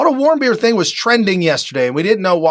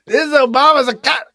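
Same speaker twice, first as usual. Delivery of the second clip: mock quote, barely holding back a laugh; 7 semitones higher, faster than usual. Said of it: "can't even say that with a straight face".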